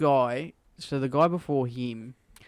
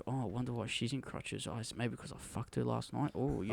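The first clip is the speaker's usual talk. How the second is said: creepy barber mutter; 3 semitones lower, 13 dB quieter than usual. Retold or imitated. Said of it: "He's like".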